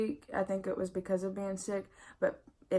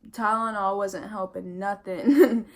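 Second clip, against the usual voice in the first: laughing; 8 dB louder than usual. Real, not acted. Exasperated laugh about useless painkiller.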